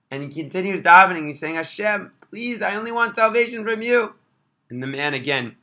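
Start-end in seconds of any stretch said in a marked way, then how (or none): 1.5-4.1 higher, pleading cry upward; desperate plea. Retold or imitated. Boy ignoring the stranger, pleading with Hashem. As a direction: cry out, begging for help.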